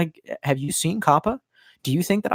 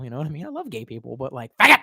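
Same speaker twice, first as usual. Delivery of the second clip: ordinary talk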